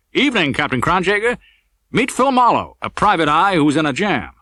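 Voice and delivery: a lightly voiced lawman enjoying himself; a cop teasing the captain with a grin; Olds, cocky investigator. A narrator's reading.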